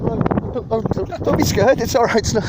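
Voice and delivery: high, gentle coaxing for a nervous dog; sweet pet talk. Real, not acted. Soothing a scared dog.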